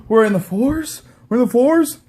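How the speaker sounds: high, gasping fake amazement; gasp, then squeal it as an excited question; mock giddy excitement. Host imagining Triple H thrilled at ratings, mock giddy squeal. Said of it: "Triple H must have a hard-on when that happens".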